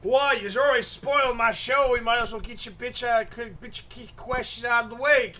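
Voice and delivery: high, loud exasperated outburst; annoyed rant. Acted. Man whose show got spoiled answers questioners, loud annoyed rant. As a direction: complain loudly, pitched well up.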